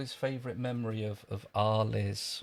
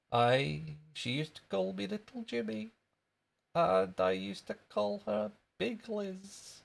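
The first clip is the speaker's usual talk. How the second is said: high Scottish schoolboy voice; little Scottish kid, piping and drawn out; Jimmy Krankie; 9 semitones higher than usual. Acted. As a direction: reminisce in a squeaky Scottish voice. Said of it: "That was wee Jimmy Cranky".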